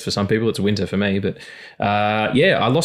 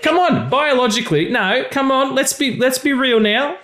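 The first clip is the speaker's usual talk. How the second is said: exasperated; 13 semitones higher than usual. Real, not acted. Mock-exasperated argument.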